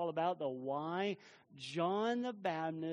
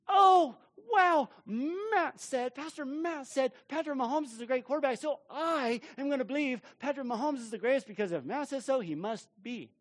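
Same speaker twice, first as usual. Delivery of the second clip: much higher, louder, gasping awe; mock gushing; 8 semitones higher, 5 dB louder than usual. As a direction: ham up a starstruck fan, fast. Mock listener gushing over pastor.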